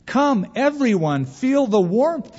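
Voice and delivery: high, drawn-out call to everyone; eager invitation. A narrator's reading. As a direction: call the whole group over in a high voice. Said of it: "she invited them".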